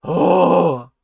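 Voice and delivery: loud, drawn-out "oh" of frustration; exasperated groan. Real, not acted. Exasperated groan after fuming.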